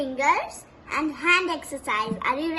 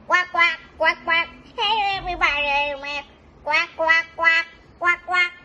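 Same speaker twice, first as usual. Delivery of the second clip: louder, higher pretend quacks; duck quacking; 4 semitones higher, 4 dB louder than usual. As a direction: quack loudly like a duck puppet greeting children. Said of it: "Now is the duck".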